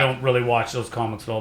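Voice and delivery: ordinary talk, nothing marked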